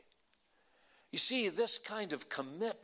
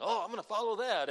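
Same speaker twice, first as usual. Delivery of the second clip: mock eager follower; 2 semitones higher, faster than usual. Mocking churchgoers chasing social media.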